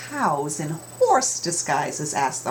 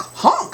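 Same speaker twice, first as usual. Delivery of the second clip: honk sound; 5 dB louder than usual. A narrator's reading.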